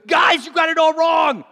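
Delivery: calling the whole crew out at full voice; urgent shout. Retold or imitated. Zacchaeus yelling at fellow tax collectors, loud and urgent.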